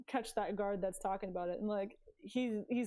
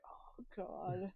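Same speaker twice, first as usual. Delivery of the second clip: softly sighed exasperation, much quieter; audible sigh; 3 semitones lower, 9 dB quieter than usual. Acted. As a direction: let it out on a sigh. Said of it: "she audibly, like, sighs".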